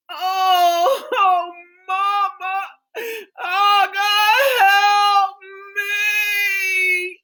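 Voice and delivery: high pained cry. Acted. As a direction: cry out to mama through the pain. Daughter crying out in labor.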